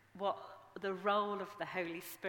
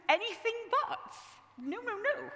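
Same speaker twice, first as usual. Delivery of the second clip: much higher and louder, squealing refusal; mock horror; 7 dB louder than usual. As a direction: recoil in hammed-up panic. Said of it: "We would go".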